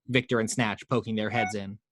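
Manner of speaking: ordinary delivery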